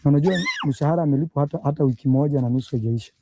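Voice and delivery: ordinary talk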